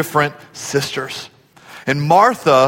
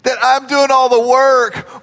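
high, drawn-out tattling complaint; mock frustrated whine; 7 semitones higher than usual. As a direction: imitate a put-upon sister telling on her sibling. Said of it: "in this frustrated tone. She's saying".

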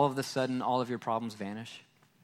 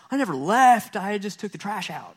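mock exasperated reply; 8 semitones higher, 6 dB louder than usual. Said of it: "And it's like".

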